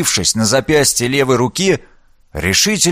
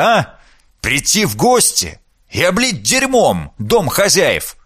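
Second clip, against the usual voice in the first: angry; 6 semitones higher than usual. Acted. Father scolds disgraced daughters.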